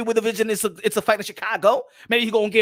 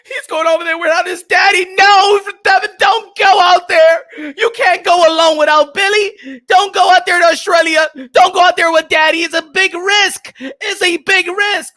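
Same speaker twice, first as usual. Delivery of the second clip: loud, higher wailing mock plea; mock crying plea; 8 semitones higher, 10 dB louder than usual. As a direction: wail like a panicked fan. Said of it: "The whole community was all here crying".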